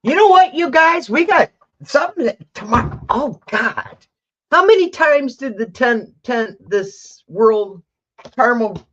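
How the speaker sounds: high, loud, excited outburst; thrilled, stumbling over words; real excitement. Real, not acted. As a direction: burst out in amazed excitement. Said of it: "I got excited when that".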